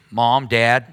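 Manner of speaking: usual speaking voice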